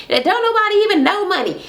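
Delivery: fast, high-pitched mock scorn; scornful retort. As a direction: imitate a dismissive put-down in an argument. Acted quarrel over a name.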